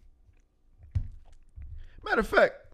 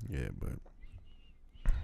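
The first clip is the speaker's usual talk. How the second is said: whisper; 10 semitones lower, 11 dB quieter than usual. Real, not acted. Whispered aside.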